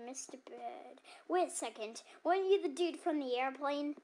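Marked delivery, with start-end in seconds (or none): 0.0-1.0 softer, musing repeat of Mister Bird; quiet muse. Acted. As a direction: say Mister Bird softly to yourself. Clerk repeats it much softer.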